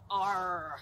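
loud, drawn-out pirate "arr"; pirate growl. Pretend. Teacher inviting children to become pirates, playful pirate growl. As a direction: let out a hearty mock pirate cry.

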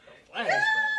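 big soldier voice. Retold or imitated. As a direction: shout it like a big army soldier. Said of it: "Big soldier voice".

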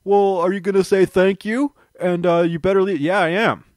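mocking host voice. Poking fun at the host's ungrateful send-off, mock snooty voice. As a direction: mimic a rude host shooing guests out. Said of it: "only to say".

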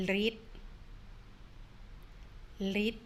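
ลิด said as lit, short vowel, falling; read aloud slowly. Shown as a demonstration. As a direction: model ลิด for students to repeat. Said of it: "อ่านว่า". (อ่านออกเสียง ลิด ช้าๆ ชัดๆ)

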